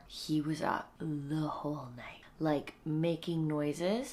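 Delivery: slow hushed whisper. Real, not acted. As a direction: draw it out under your breath. Quiet talk, baby sleeping.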